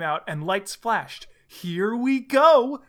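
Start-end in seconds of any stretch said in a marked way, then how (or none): 1.5-2.8 slow, high, loud announcement; shouted. A narrator's reading. Tails launching Sonic through time, drawn-out shout. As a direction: stretch it out in an acted shout. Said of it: "shouted Tails".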